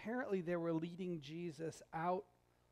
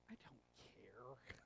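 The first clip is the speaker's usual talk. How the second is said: slow, soft, couldn't-care-less mumble; dismissive shrug; 9 semitones lower, 21 dB quieter, much slower than usual. Pretend. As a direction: brush it off, slowly and quietly. Imagined reply to HOA notice.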